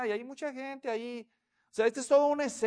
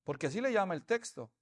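ordinary delivery

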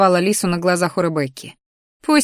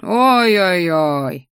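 slow, drawn-out "oh-oh-oh" of mock disbelief; eye-rolling; much slower than usual. A narrator's reading. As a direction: roll your eyes as you say it. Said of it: "закатил глаза мой ящер".

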